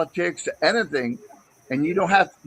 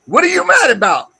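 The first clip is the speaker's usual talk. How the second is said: loud, higher, heated echo; angry shout; 6 semitones higher, 5 dB louder than usual. Real, not acted. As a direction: throw the question back, shouting.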